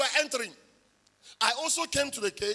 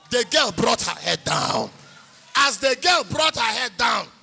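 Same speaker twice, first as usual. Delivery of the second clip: louder, driving the punchline home; triumphant preaching; 2 semitones higher, 8 dB louder than usual. Real, not acted. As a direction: build to the win. Preaching climax of the testimony.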